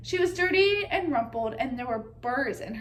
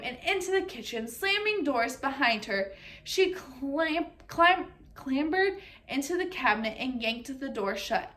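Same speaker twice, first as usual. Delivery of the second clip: ordinary delivery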